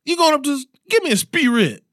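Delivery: squeaky imitation of Kenny asking for a "Spirit"; imitating Kenny. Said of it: "he would call Sprite Spirit".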